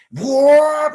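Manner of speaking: loud, high mock whoop. Imitating the man having a great time, excited whoop. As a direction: let out a high whoop of delight. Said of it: "He was laughing".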